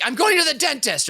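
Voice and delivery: high mock yell over the shoulder; yelled excuse. Boss fleeing out the back door, high mock yell. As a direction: call it out while hurrying away. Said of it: "yells, like".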